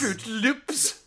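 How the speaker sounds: put-on loud, high Norwegian accent. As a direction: echo the character in a hammy Norwegian accent. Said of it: "said in a Norwegian accent".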